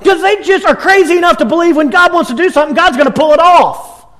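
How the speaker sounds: soaring, driving preacher's build; fervent preaching. Real, not acted. Preaching climax, charging headlong.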